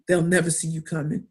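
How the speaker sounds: ordinary talk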